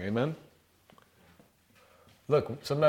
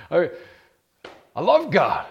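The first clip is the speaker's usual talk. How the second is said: delighted outburst; 6 semitones higher, 8 dB louder than usual. Real, not acted. Preacher erupts after the scripture.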